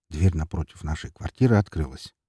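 usual speaking voice

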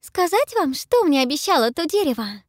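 high voice full of awe; awed. A narrator's reading. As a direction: speak in reverent wonder. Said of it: "голос полон благоговения".

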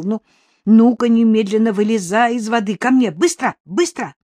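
high, loud urgent commands; shouted orders. A narrator's reading. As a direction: call the dog out of the water urgently. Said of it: "на эти крики".

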